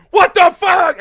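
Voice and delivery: high panicked shout; startled shout. Retold or imitated. Retelling his panic at the siren, startled high shout. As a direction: yell it out in shock.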